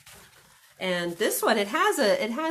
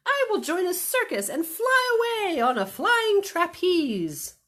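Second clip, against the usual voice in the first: high, loud little bunny; 12 semitones higher, 8 dB louder, slower than usual. Acted. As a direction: play the runaway bunny, bright and eager. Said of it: "said the little bunny".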